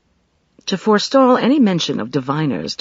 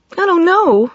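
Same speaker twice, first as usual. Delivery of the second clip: high, louder anguished cry; cried out; 6 dB louder, slower than usual. A narrator's reading. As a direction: cry it out in distress. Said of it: "she cried".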